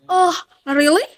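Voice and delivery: questioning upswing on "really"; rise intonation. Shown as a demonstration. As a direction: lift "really" steeply at the end. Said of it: "for rise intonation".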